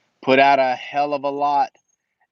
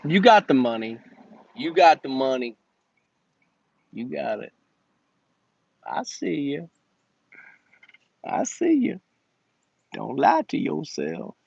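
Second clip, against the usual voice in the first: a teasing impression of a funny Instagram kid; imitating an Instagram comedian; 2 semitones higher than usual. Mimicking a funny Instagram kid to cheap customers, teasing. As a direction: tease them like the comedian, knowing and sly. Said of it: "What's that kid on, uh, Instagram?".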